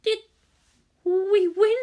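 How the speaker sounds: lightly voiced girl, high; a worn-out girl barely asking; injured girl straining to ask; weak girl's voice. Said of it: "It took all the girl's strength just to ask".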